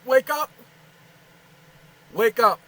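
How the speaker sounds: loud barked orders at the machine; shouting commands. Real, not acted. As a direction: yell at the device to wake it.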